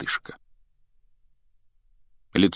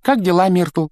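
forced, bright, higher greeting; put-on cheer, a touch louder; fake cheerful; 11 semitones higher, 6 dB louder than usual. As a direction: sound cheerful when you're not. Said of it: "делано весёлым тоном".

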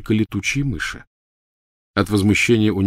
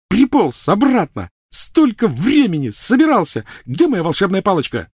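put-on furious girl; 12 semitones higher, 4 dB louder than usual. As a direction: spit each word out with a blow. Hermione raging at Ron.

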